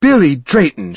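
loud, stern mother calling out; thundering scold. Acted. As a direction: boom his full name to stop him. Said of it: "Steph thundered".

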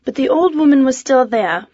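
plain reading voice

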